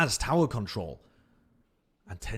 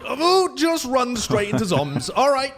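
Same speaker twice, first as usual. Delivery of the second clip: loud, high, surprised laughing reaction; excited outburst; 13 semitones higher, 9 dB louder, slower than usual. Real, not acted. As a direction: burst out with real amazement.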